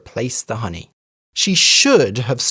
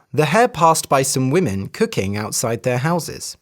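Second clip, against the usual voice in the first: ordinary delivery, nothing marked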